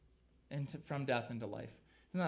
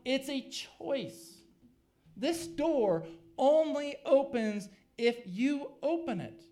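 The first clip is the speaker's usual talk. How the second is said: loud, higher-pitched sermon delivery; forceful preaching; 7 semitones higher, 7 dB louder than usual. Real, not acted. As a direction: drive each phrase home to the congregation.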